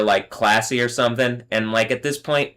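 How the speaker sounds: normal talking voice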